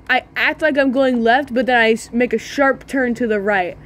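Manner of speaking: ordinary delivery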